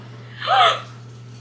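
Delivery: sharp breathy gasp drawn in high; a sudden gasp of fright; horrified gasp. Retold or imitated. Reacting to the scary scene.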